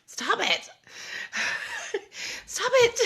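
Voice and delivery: high squealing laugh through the words; laughing stop it. Real, not acted. Mom gushing at her son's kindness, high laughing squeal. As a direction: squeal it out, overwhelmed by sweetness.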